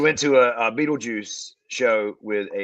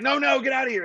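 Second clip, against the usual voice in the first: mock shooing; 12 semitones higher than usual. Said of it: "They were like".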